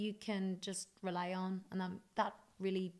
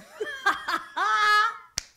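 loud high burst of laughter; big laugh; 13 semitones higher, 13 dB louder than usual. Real, not acted. Singer laughing at her own [name] joke, loud laugh. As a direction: crack up at the punchline.

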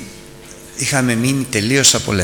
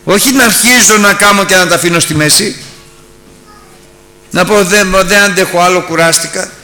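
slow, louder, higher preaching voice; impassioned preaching; 7 semitones higher, 6 dB louder, slower than usual. Acted. Preacher giving Christ's answer to the disciples, impassioned. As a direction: proclaim Christ's words with rising force.

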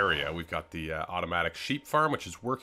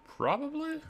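squeaky voice. Acted. Squeaky throwaway word.

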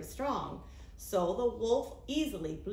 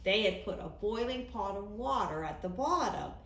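plain reading voice

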